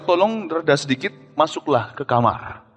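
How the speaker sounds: soft, slow, kindly pastor's hush; calm them down softly; gentle request. Retold or imitated. (permintaan lembut dan pelan)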